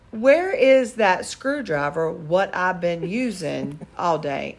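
imitating slow drawl. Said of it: "he says to me".

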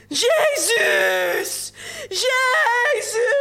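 high, loud screams of pain; a woman in labour crying out from the next room; screaming. A narrator's reading. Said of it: "schreeuwde de vrouw in de volgende kamer".

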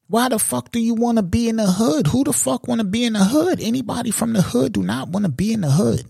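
high-pitched incredulous rhetorical questioning; exasperated disbelief. Real, not acted. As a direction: pitch it well up, truly baffled.

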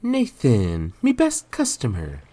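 high, loud greeting; woman hailing a customer. A narrator's reading. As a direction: call out brightly to a regular customer. Giselle calls out to Nathan.

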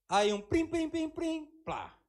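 playful mock chime; magic sound effect. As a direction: mimic a magic wand. Mocking a magic fix.

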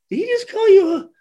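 high, stunned question; shocked teammate. Retold or imitated. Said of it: "he's like".

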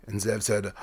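ordinary delivery

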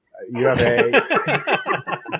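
slow words broken by laughter; can't get the words out for laughing. Real, not acted. Host laughing at his guest's accent.

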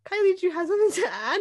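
high, fast mock teacher voice, laughing; half-laughing imitation of a teacher. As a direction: quote the teacher lightly while laughing. Teacher calls on her.

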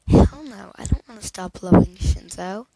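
loud, sharp refusal; defiant outburst. A narrator's reading.

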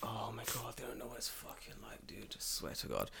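hushed conspiratorial whisper. Real, not acted. Agreeing with host that they don't know, whispered. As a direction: breathe it, barely voiced.

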